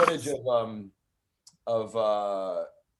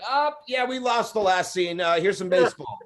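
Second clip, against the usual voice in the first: mock casual network; 7 semitones higher, 3 dB louder than usual. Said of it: "A B C's like".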